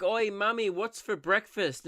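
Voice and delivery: put-on high, slow little kid asking mum; a lightly voiced child pleading for breakfast; mock hungry child. Starving peasant child asking mummy, lightly voiced kid. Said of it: "that you're like".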